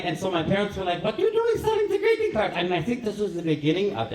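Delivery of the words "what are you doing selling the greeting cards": very high-pitched astonished question; mock parental disbelief. Parents questioning their little boy about greeting cards, mock astonishment. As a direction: imitate scandalized parents, voice shooting up high. Startled parents confront child, retold.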